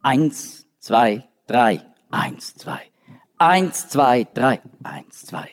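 three beats, then two beats dropping to a near whisper; beating out the meter. Shown as a demonstration. Teacher tapping out the three plus two meter of Lamentations. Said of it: "das Typische für Klagelied ist drei plus zwei".

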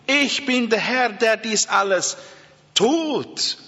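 ordinary talk, nothing marked

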